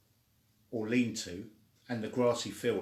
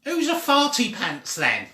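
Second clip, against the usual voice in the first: high, loud squawking parrot; 12 semitones higher, 10 dB louder than usual. Acted. The parrot teasing Pie Weasel in a high, loud squawking voice.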